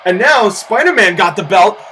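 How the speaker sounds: ordinary delivery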